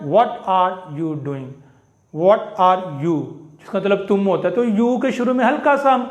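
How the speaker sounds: ordinary delivery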